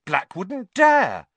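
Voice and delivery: spirited boast to Hermione. Acted. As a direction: spell it out defiantly. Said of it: "said Ron spiritedly to Hermione".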